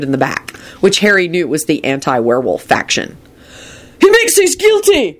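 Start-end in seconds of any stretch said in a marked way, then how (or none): 4.0-5.2 high-pitched angry outburst; angry holler. A narrator's reading. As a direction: holler an accusation across the courtroom. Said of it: "hollered out angrily".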